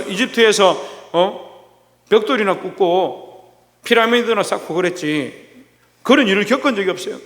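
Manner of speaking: ordinary delivery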